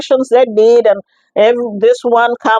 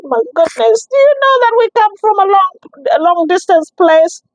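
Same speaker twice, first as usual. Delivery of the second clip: high, quick, exasperated protest; exasperated plea; 10 semitones higher than usual. Retold or imitated. Patient pleading with clinic staff after long drive, exasperated high protest. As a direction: plead how far you've come, voice climbing high.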